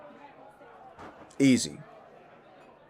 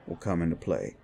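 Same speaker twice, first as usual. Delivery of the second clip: usual speaking voice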